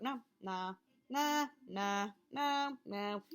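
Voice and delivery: drawn-out, annoying "nah"s; pestering string of nos; annoying repeated refusal. Shown as a demonstration. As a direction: keep refusing like a broken record, to annoy. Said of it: "or be an annoyance".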